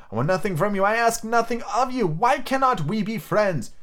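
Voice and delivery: high, earnest appeal; Scrooge's nephew, entreating. Acted. Nephew appealing to his uncle, high entreating voice.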